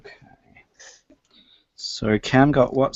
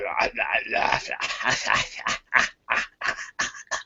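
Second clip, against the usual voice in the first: breathy echoing skull laugh; 2 semitones higher than usual. Acted. GM voicing the laughing skull, eerie cackle.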